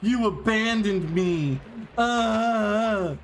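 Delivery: high, slow, hammed-up girlfriend yelling; mock angry yell. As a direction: whine it like a clingy accusation. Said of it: "she yells at him afterwards".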